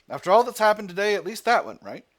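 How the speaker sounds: brisk relieved officer; British officer. Acted. Voicing wounded British officer.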